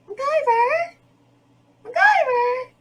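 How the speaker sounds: very high, squealed call; thin mock scream of a name, stretched at the end; imitated girl's cry. Imitating the girl calling out to MacGyver, high-pitched squeal. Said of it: "that's pretty dead on, I guess".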